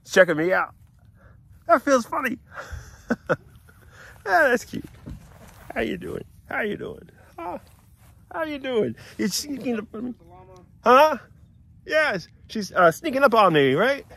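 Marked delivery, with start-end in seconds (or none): 1.7-4.8 high, louder giggling; giggly delight. Real, not acted. Man tickled by a sniffing llama, high giggling. 5.7-14.1 high, louder cooing to the animals; high pet talk. Real, not acted. Man cooing at llamas following him around.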